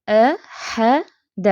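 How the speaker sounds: احد drawn out very slowly; احد stretched slowly, each letter kept light; recitation demo. Shown as a demonstration.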